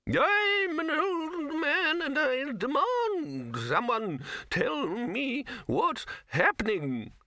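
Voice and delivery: put-on very high, slow, drawn-out old man's wail; indignant old wizard. Old wizard demands an answer, indignant and doddery. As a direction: demand it in a quavering, reedy old voice.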